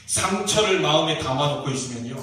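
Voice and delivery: ordinary delivery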